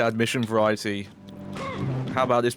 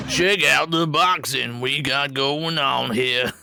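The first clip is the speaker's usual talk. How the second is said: boxing ring announcer; 3 semitones higher, slower than usual. Retold or imitated. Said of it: "such a weird boxing announcer voice".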